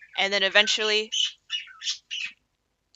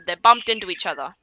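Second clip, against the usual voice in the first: ordinary talk, nothing marked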